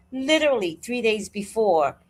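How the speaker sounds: normal talking voice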